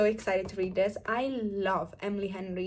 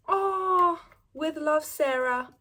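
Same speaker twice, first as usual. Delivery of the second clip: normal talking voice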